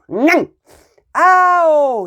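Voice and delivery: high, loud yelp; cry of pain. A narrator's reading. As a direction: yelp as the dog bites. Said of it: "he cried".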